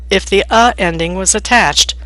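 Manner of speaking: usual speaking voice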